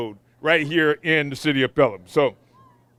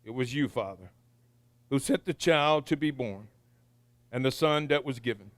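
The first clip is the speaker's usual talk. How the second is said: prayer; 4 semitones lower, 3 dB quieter than usual. Acted. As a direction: pray quietly with reverence. Pastor prays the invocation.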